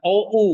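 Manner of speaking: "O U" spelled out as two separate vowels; teacher sounds out the mistaken "O U"; wrong reading. Shown as a demonstration. Teacher warning students, reads the wrong "O U". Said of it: "Nah, ini bacanya U. Bukan O U".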